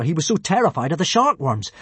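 usual speaking voice

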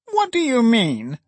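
high, sharp question from Filer; sharp cry. A narrator's reading. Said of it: "cried Filer sharply".

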